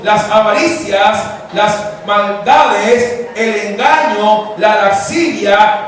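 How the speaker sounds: cada pecado lento, marcado y cada vez más fuerte; enumeración enfática. Acted. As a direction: golpea cada palabra de la lista, subiendo. Sermon listing sins emphatically. (predicador recitando los pecados, cada vez con más fuerza)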